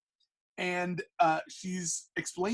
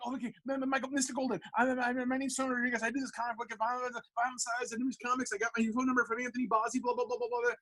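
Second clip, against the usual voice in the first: high, soft, fast gabble; nervous rambling; 6 semitones higher, 3 dB quieter, faster than usual. Retold or imitated. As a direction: spill it all out like a flustered teenager. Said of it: "I just start rambling".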